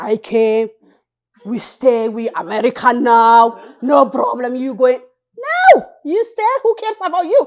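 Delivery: loud, high, pushy declaring; loud insistence. Retold or imitated. Ethiopian group loudly telling the newcomer to stay. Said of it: "they came".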